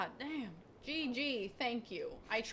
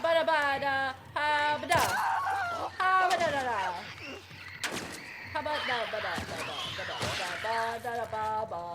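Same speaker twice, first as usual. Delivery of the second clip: panicked nonsense noises. Real, not acted. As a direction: babble to calm your nerves. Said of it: "I'm just panicking, so I'm making noises".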